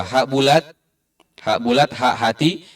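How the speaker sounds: ordinary talk, nothing marked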